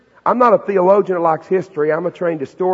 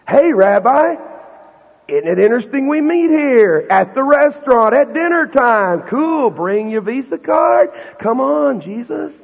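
higher, louder, slow and showy crowd voice; mocking freeloading crowd; 8 semitones higher, 3 dB louder, slower than usual. Preacher mocking the crowd that wants a free meal, showy and loud. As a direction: ham up the crowd begging for free food. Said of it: "What they're saying is".

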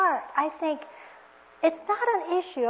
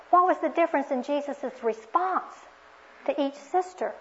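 normal talking voice

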